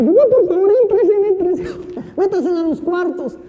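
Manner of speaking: high, loud panicked women urging everyone inside; panicked women yelling down the hallway; frantic shouting. Retold or imitated.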